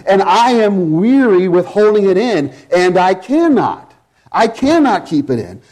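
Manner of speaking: high, driving pulpit delivery; impassioned preaching. Real, not acted. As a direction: preach it with rising urgency and heavy emphasis. Preaches at full force.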